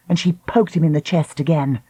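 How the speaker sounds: usual speaking voice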